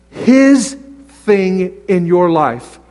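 "his" drawn out very slowly and falling; slowed emphasis. Shown as a demonstration. Preacher stressing God's will to his church, very slow on "his". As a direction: stretch "his" way out. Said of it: "slowed emphasis".

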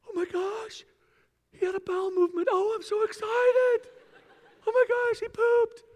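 high, loud squealing delight with laughter; gushing new parent. Retold or imitated. New parent thrilled over the baby's first poop, squealing.

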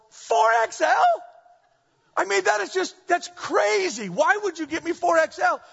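hammed-up disbelief; mock outrage. Husband protesting four X L to wife, mock outraged. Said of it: "I go".

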